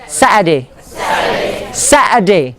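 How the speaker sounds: "Saturday" as "SAT-uh-day", no r; British "Saturday" stressed on the first syllable; British pronunciation. Shown as a demonstration. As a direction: soften the middle of "Saturday" to "uh". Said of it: "the British would say as".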